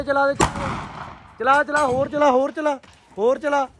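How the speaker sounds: high-pitched eager calling out; excited urging. Real, not acted. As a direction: urge him on, excited and insistent. Urging friend to fire crackers. (ਜੋਸ਼ ਨਾਲ ਉੱਚੀ ਸੁਰ ਵਿੱਚ ਹੱਲਾਸ਼ੇਰੀ)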